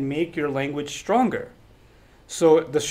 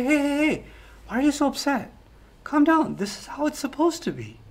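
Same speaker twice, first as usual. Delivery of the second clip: softer, higher placating voice; calm, placating; 7 semitones higher, 5 dB quieter than usual. Acted. Manipulator to someone speaking out for truth, in a soothing calm tone. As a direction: gently talk someone down, as if nothing is wrong. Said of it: "now my calm tone becomes".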